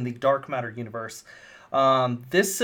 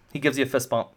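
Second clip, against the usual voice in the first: ordinary delivery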